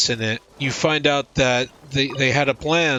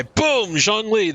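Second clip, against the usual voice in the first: dramatic reveal; 8 semitones higher, slower than usual. Real, not acted. A fan reveals the god to viewers, excited and high. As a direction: announce him like a surprise unveiling.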